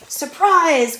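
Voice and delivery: loud, high wolf pounce cry; wolf's cry. Acted. Wolf bursting in on Granny with a loud high cry. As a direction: burst in shouting it. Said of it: "cried the wolf".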